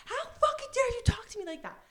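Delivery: high, fast indignant scolding; outraged retort. Retold or imitated. Friend telling off the man, high outraged rush. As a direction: imitate a friend who won't take the insult. Retold friend snapping back at insult.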